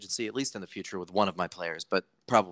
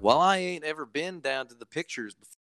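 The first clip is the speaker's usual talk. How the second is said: higher, louder mock Southern accent; Virginia accent; 5 semitones higher, 4 dB louder than usual. Podcaster mimics improper hometown speech, exaggerated Virginia accent. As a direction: put on a hammed-up Virginia country accent. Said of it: "everybody has more of an accent like".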